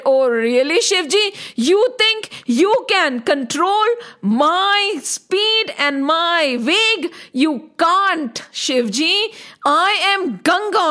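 proud, forceful river goddess; defiant challenge. Acted. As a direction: challenge him, drawing out the key words. Ganga defiantly challenges Shivji.

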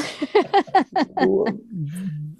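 a long, trailing real laugh; real laughter. Real, not acted. Guest still laughing at the beach joke, long laugh. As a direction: keep laughing as it fades.

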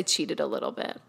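sheepish whispered confession; whispering. Real, not acted. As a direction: whisper it like a guilty secret. Sheepish admission of cheating.

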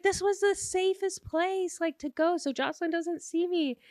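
high-pitched defensive whine; acted high-voiced justification; mock flustered excuse. Retold flustered excuse from bathroom.

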